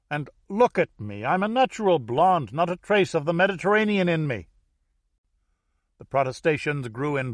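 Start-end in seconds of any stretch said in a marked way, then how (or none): 0.5-4.5 higher-pitched protest from the crowd; indignant outburst. A narrator's reading. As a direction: object indignantly, voice raised in pitch. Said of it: "The audience exploded as one".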